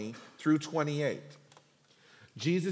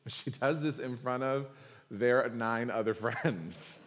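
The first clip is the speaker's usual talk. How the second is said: ordinary delivery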